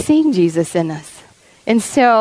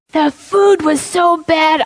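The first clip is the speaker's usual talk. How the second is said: shouted, slowed, higher; mock shout; 6 semitones higher, slower than usual. Chuck telling Darla his story, shouting the complaint. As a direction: bellow the complaint as rough Chuck. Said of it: "he goes".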